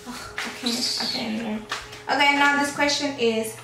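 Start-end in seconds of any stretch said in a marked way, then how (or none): none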